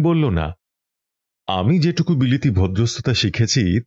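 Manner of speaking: plain reading voice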